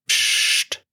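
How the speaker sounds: breathy shush; soft shushing. Acted. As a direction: hush him with a soft psst. Finger on his lips, hushing.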